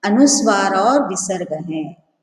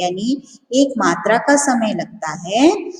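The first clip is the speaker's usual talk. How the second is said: ordinary talk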